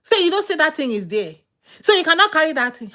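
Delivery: loud, high-pitched ordering about; disrespectful bossy scolding. Retold or imitated. Wife talking down to husband, loud bossy mimicry. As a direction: imitate a wife scolding her man loudly. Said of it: "there's a way you don't talk to the man".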